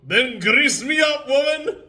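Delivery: host hams up a loud, slow Willie; Groundskeeper Willie. Retold or imitated. Host quoting the Simpsons to his guest, hammed-up Willie bellow. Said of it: "That's Willy, basically".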